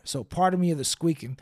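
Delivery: normal talking voice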